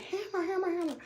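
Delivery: high, soft sing-out of the name; calling the dog. Retold or imitated. Owner on other side of woods, soft high mock calling.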